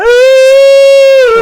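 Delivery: high shrieking laugh; loud real laughter; big loud laugh. Real, not acted. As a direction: burst out laughing hard. Laughing at his own story.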